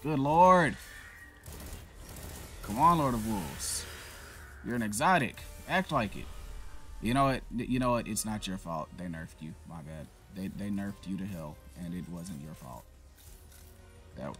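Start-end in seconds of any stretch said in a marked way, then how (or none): none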